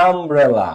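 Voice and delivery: আমব্রেলা opening with an open 'a', no 'yu'; a sound demo. Shown as a demonstration. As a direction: begin আমব্রেলা with a plain 'a'. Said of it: "আমব্রেলাতে আ হয়ে গেছে".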